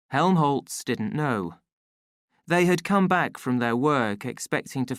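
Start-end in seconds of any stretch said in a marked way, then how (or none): none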